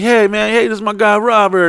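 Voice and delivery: high, upbeat introduction; hyping up a friend. Retold or imitated. Introducing Robert to girls with a light, high hype. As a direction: talk him up to the girls, pitched high. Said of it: "I'm like".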